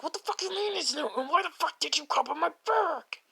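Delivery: strained, held-back mock yell; mock angry outburst. Bakugo angrily confronts the cat.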